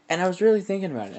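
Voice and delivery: fast, high and loud; excited rush. Real, not acted. Telling viewers his idea, fast and excited. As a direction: race through it, fired up.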